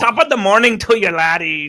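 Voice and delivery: high, loud stage-Irish greeting; mock Irish accent. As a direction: call it out in a playful fake Irish accent. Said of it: "a bit Irish, as [name]'s trying to".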